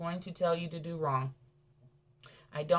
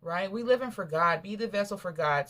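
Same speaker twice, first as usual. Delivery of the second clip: normal talking voice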